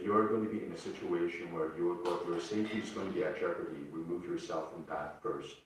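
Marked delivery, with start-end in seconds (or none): none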